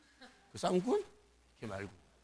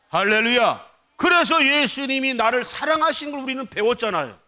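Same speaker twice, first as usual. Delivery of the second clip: loud, high-pitched pulpit proclamation; full-force preaching; 9 semitones higher, 10 dB louder than usual. Real, not acted. Pastor telling congregation of Jesus' command to love, impassioned preaching. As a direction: drive it home to the whole congregation.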